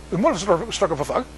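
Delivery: mumbled, hurried mock Manchester accent. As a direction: run it together in garbled Northern English. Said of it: "they talk really weird there".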